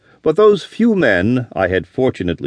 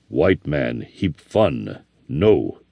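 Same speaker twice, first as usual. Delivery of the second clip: lower, softer and slow, amused disbelief; scoffing Navajo; 7 semitones lower, 4 dB quieter, much slower than usual. A narrator's reading. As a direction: scoff it slowly, deep and quiet.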